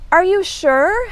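mild, high-pitched storybook shout. A narrator's reading. Hedgehog calling down to Mouse, mildly shouting. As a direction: call down into a hole, lightly. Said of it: "Hedgehog shouted".

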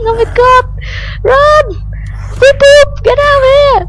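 very high, loud frantic shouting; panicked screaming. Real, not acted. Player running from zombies for their life, panicked screaming.

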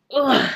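loud strained grunt; effort grunt. Real, not acted. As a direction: grunt with effort while lifting. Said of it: "Sorry, I keep grunting".

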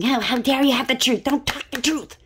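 high, loud indignant outburst; aggressive. Acted. Aggressive one confronting the boy with the Sword of Truth, indignant. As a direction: lash out in an acted, outraged voice. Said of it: "This one's aggressive".

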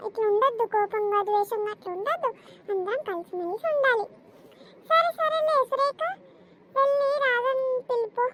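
ordinary talk, nothing marked

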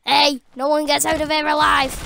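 ordinary talk, nothing marked

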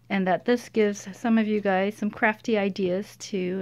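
usual speaking voice

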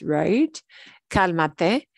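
normal talking voice

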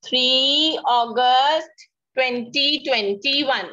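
very slow, word by word date; dictating slowly. Shown as a demonstration. Teacher dictating the date, very slow. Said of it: "mama can write the date".

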